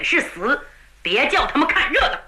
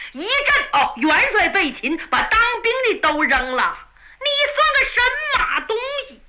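tag; 7 semitones higher than usual. A narrator's reading. Niu Gao berates the captive.

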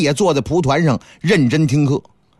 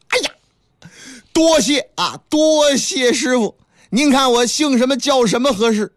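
higher, pleased thanks, slowing on the bow; eager monkey disciple; 6 semitones higher than usual. Acted. Wukong thanking master for a name, higher eager voice. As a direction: ask keenly what your name will be.

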